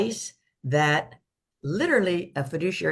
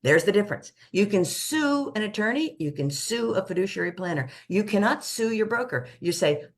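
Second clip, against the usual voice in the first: ordinary talk, nothing marked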